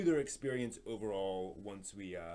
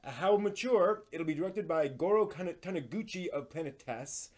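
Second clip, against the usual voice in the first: brisk, upbeat news host read, a bit louder; lively, a little louder anime news presenter voice; 5 semitones higher, 9 dB louder than usual. Acted. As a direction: present the week's anime headlines like a show host. Hosts weekly anime news segment.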